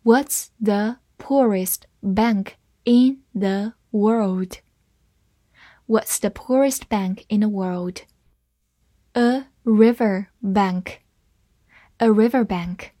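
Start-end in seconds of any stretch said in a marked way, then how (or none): 0.0-4.6 slow, each word set apart; careful teacher's model for repeating; slow reading. Shown as a demonstration. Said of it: "我们慢慢来读一下". 9.1-11.0 slow, three words set apart; spaced-out model for learners; slow reading. Shown as a demonstration. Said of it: "我们慢慢来读一下".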